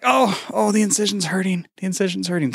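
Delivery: high, hammed-up complaint of pain; mock pained patient. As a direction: play a patient whose stitches hurt from laughing. Acting out listener laughing painfully.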